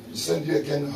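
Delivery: ordinary talk, nothing marked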